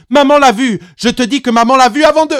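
put-on high, loud, rushing child; boy shouting back at his father; defiant shout. A narrator's reading. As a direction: blurt it out fast and defiant. Boy defies father, cut off.

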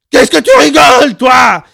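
very loud, very high derailing shriek; unhinged screech at full volume; shrill cracking scream. A narrator's reading. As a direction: scream till the voice cracks upward. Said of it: "beaucoup plus fort et d'une voix beaucoup plus aiguë qui déraillait".

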